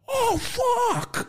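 very high East Coast accent impression; Peter Dante. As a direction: wail it in a hammed-up East Coast voice. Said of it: "The accent is".

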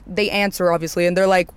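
ordinary talk, nothing marked

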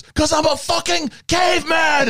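high-pitched movie-quote scream; imitated scream. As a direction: scream it out, acting an enraged man. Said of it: "he screams as they're running down".

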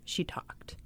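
whispered, barely audible; mock whisper. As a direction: hush it like a secret. Mocking her finally speaking.

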